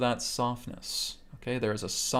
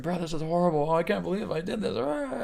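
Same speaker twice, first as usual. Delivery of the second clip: high, fast panicked wail; freaking out; 7 semitones higher than usual. Retold or imitated. Student reliving a bad memory to the teacher, higher and panicky. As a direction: play someone spiraling into panic over a memory. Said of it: "they just start freaking out".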